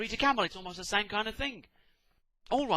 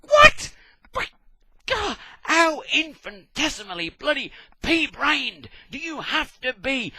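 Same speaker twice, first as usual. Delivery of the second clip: high, loud outraged yell; a loud, slowed incredulous rant; incredulous shout; 7 semitones higher, 9 dB louder, slower than usual. Retold or imitated. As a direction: explode in disbelief. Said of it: "And you go".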